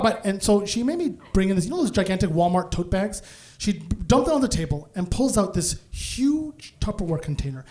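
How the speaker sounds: normal talking voice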